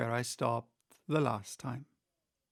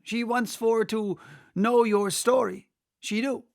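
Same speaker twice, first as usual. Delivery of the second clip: higher, louder, coarse griffin; brash griffin; 8 semitones higher, 6 dB louder than usual. Acted. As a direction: announce her bluntly like a rough beast. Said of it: "said the griffin".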